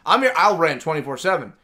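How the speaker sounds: ordinary talk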